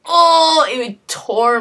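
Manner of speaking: a sudden high, loud oh of heartbreak; anguished cry. Retold or imitated. Leaving Walter behind in the game, a pained outcry. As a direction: cry out as if it hurts. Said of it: "And I'm just like".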